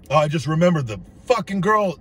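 ordinary talk

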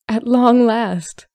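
high, loud, drawn-out relief; exclaiming. A narrator's reading. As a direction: stretch it out like an overjoyed mother. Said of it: "her mother exclaimed".

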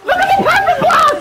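high, laughing scream of disbelief; real squealing indignation; outraged shriek. Real, not acted. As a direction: screech it way up high, half laughing. Outraged at another perfect block.

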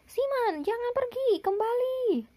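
high, pleading call after someone. A narrator's reading. Pak Badru calls after Siman leaving by balloon. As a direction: call him back before he leaves. Calling after departing friend.

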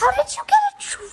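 shocked high cry. Real, not acted. Sister shocked at stolen light.

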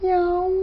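high mewing yao like a cat; cat meow. Shown as a demonstration. Teacher imitating a cat's meow for the class. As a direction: mew it out high and soft like a cat. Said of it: "Ça désigne le son de yao".

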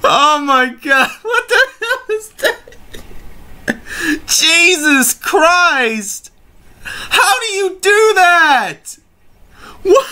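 high, laugh-cracked cries of disbelief. Real, not acted. Vlogger reacting to a bizarre meme, laughing high. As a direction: squeal through the laughter.

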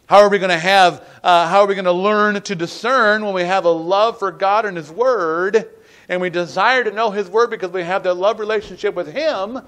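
forceful preaching. Acted. Preacher on loving God and His Word, forceful preaching. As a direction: press the point on the congregation.

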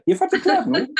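high laughing aside; amused laughing comment. Real, not acted. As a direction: laugh through the punchline. Laughing at the absurd advice.

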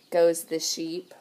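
plain reading voice

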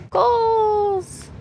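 shouting the store name; loud happy call-out; excited shout. Real, not acted. Spotting the sign.

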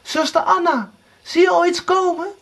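high, urgent cry; desperate call. A narrator's reading. Erika calls again to Anna, desperately. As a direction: call up in despair. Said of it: "Erika riep wanhopig".

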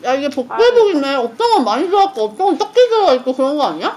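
talking between bites; chewing while talking. Real, not acted. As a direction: mouth partly full of pastry. Seen 1: Ate pastry just before; lips pursed, chewing while talking, holding pastries.

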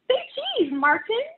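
put-on high, loud child voice calling say cheese; a delighted kid snapping pictures; excited little girl. Ruthie photographs everything with her tiny camera, high and gleeful.

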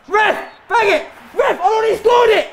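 high, loud appeal to the ref; indignant high-pitched shouting; shouted protest. Real, not acted. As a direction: yell at the referee in outrage. Protesting to the referee in game.

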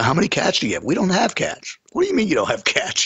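incredulous retold questioning; retold bafflement. Retells baffled argument about cats.

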